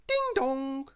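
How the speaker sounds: high sung doorbell chime; doorbell ding dong. Acted. Imitating a doorbell.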